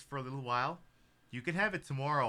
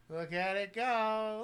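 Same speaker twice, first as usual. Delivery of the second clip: slow sing-song tease, higher; slow, higher teasing; 7 semitones higher, much slower than usual. Acted. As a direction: drag it out, rising. Dangling toy, building.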